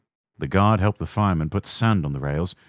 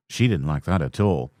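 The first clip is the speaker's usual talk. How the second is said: ordinary delivery, nothing marked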